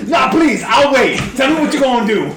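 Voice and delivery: high, loud sarcastic invitation; hammed-up taunting shout; goading adventurer. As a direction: dare the archer to go on. Player goads archer in character.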